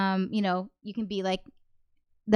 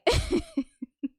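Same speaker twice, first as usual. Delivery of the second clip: laugh; 14 semitones higher, 4 dB quieter than usual. Real, not acted. Laughs at things going off.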